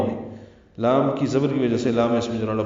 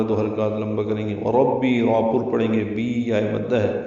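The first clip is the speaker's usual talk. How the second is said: ordinary delivery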